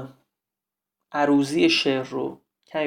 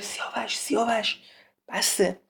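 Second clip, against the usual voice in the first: higher, much softer warning call; urgent hushed calling; 4 semitones higher, 8 dB quieter than usual. Acted. Navid tries to stop Siavash, hushed calling. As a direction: call the name under your breath to stop him.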